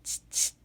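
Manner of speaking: unvoiced clicking tch to urge cows on; soft tongue clicks behind the herd; urging tch. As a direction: click the tongue to move them along. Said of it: "I urge from behind".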